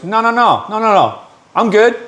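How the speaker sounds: a little high, eager no; lightly voiced tweaker. Injured tweaker giving his cot to the father, eager. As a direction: wave it off like a kind stranger.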